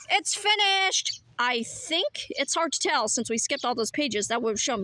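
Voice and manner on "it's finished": high, slow and proud; triumphant announcement. Acted. Inventor proudly unveiling the finished device, triumphant. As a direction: declare it done with a flourish.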